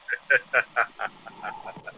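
long staccato chuckle; mocking laugh. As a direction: laugh at his writing. Intruder laughs at the read passage.

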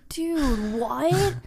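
drawn-out, incredulous "dude"; mock-stunned, rising into a question; baffled reaction, played up; retold disbelief. Acting out her stunned reaction.

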